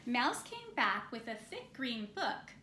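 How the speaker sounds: usual speaking voice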